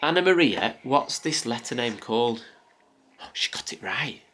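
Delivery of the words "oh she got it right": hushed, breathy aside; whispering. Real, not acted. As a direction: whisper it so she won't hear. Secret aside during toddler game.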